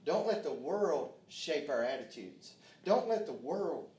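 loud, high-pitched exhortation to the congregation; full-force preaching. Real, not acted. Preacher warning the church about the world, loud, fervent preaching. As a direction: preach it hard, hitting key words.

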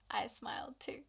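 whispered confession; soft whisper. Retold or imitated. Husband admitting he smiled, whispered shyly. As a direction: breathe it out shyly.

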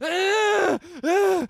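high, loud terrified scream; panicked scream. Retold or imitated. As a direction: burst out screaming on waking. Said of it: "I'm like".

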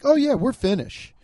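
high, breezy mock pride; sing-song fake heritage boast; mock proud Finn. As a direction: toss it off like a proud descendant. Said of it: "you're like".

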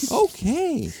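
very high falsetto okay; put-on wife voice; agreeing wife. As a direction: chirp agreement in a woman's falsetto.

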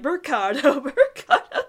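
mock chant dissolving into laughs; imitating excited characters. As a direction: echo their cheer, breaking into laughter. Imitating the characters cheering, then laughing.

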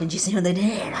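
mock the excitement in an odd put-on voice; feverish excitement. Reader spoofing Ralph's feverish excitement, hammed-up. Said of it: "feverish excitement in his voice".

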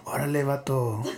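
put-on Mexican street accent. Shown as a demonstration. Asked for a demonstration, comic Mexican accent. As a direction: greet a buddy in a Mexican accent. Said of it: "do a really good Mexican voice".

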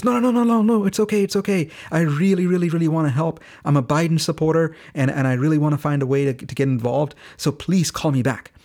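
high, loud rush of reassurance, easing gradually; imitated eager insistence. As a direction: act out brushing off an apology, keen to help. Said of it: "he actually was excited".

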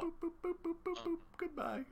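high, thin boops like a bleep tone; censor bleep noise. Pretend. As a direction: beep the guest off the air. Bleeping out Pauly.

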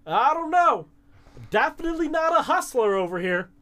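exaggerated high-voiced act to lure a bet; [name] playing it up. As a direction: play up being hopeless, way up high. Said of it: "[name] is playing it up".

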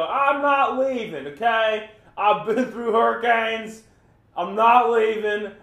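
loud, slow, high hammed-up Southern drawl; stubborn hurricane holdout in a mock North Carolina accent. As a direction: play a stubborn North Carolina local refusing to leave. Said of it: "That's my North Carolina accent".

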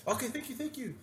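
high-pitched mock thanks; high girlish impression of gratitude; imitated grateful girl. Voicing the grateful Italian girl.

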